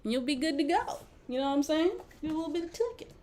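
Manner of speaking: high, folksy mock Southern twang; fake Southern accent. Shown as a demonstration. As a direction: keep up the put-on Southern accent. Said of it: "I can fake a Southern accent".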